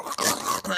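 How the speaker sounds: voiceless rasping sound effect; mouth-made squelchy crunch; imitated burrowing. Bullets going in your organ, imitated burrowing.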